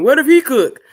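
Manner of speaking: loud, high mocking quote; mock outrage. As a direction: play up the disbelief.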